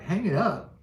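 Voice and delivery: put-on high, puzzled kid voice, slowing on the second question; confused kid. A kid who has never seen a wall phone, high and confused. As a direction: play the baffled modern kid. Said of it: "And they're like".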